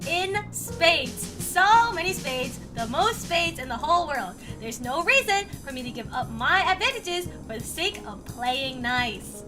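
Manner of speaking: cartoonish Trump accent with stretched words; South Park Trump accent. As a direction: boast in a caricatured Trump accent. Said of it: "That's exactly like South Park Trump".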